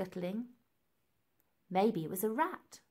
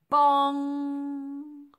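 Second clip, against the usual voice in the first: loud, high bong with a long ringing hum; sung-out bell strike, held on the ng; ringing bell imitation; 6 semitones higher, 9 dB louder than usual. A narrator's reading. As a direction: strike it hard and let it ring.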